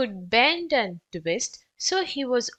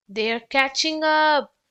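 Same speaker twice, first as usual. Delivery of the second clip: higher, louder panicky yelp; 4 semitones higher, 5 dB louder than usual. Acted. Tom fleeing the bees, panicky yelp. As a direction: yelp it as you run. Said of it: "Tom yelped".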